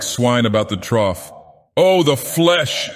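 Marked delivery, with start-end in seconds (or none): 1.7-2.9 high slow tormented cry; anguished cry. A narrator's reading. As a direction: wail it through clenched teeth. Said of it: "ground his teeth".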